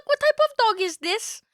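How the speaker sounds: high-pitched squeaky question. Acted. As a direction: ask it in a squeaky voice. Repeats in high voice.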